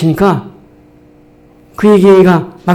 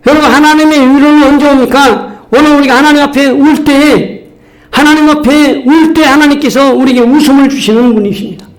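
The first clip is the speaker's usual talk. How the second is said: full-force preaching; 7 semitones higher than usual. Real, not acted. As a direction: preach it out to the congregation.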